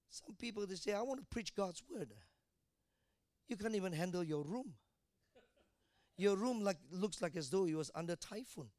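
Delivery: usual speaking voice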